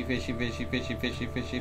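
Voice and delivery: ordinary talk, nothing marked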